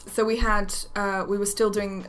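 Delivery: usual speaking voice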